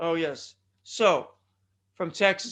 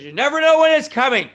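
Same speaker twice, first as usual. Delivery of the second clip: sudden hyped warning; 8 semitones higher, 12 dB louder than usual. Real, not acted. Warning listeners about earthquakes, suddenly loud and hyped. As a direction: voice leaps up loud.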